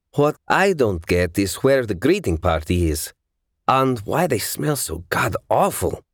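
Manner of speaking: mildly disgruntled complaint with lifted stresses. A narrator's reading. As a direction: grumble as a put-upon character. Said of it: "punctuated his words with a disgruntled grunt".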